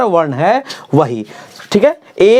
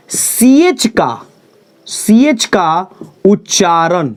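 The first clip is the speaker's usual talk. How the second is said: slow emphasis; slower than usual. Shown as a demonstration. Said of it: "ध्यान से".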